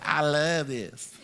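slow, lingering, covetous "I love this"; possessive adoring. Shown as a demonstration. Pastor showing the congregation a covetous tone. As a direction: savour the words like a treasure you won't share. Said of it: "God can tell the distinction in my tone".

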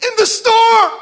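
high-pitched, drawn-out disbelief; incredulous exclamation. Acted. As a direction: squeal it in mock disbelief. Incredulous that Jesus is napping.

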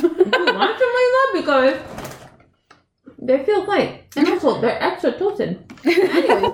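high giggly voice through laughter; laughing. Real, not acted. Giggling while offering her snacks.

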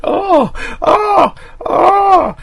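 high breathy fake moans; mock moaning. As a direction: ham up a dubbed scream-moan. Mocking dubbed horror film moans.

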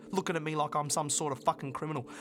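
normal talking voice

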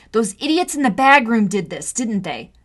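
loud, furious accusation; indignant sister; angry. Acted. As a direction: spit it at the culprits. Angry at bag room bullies.

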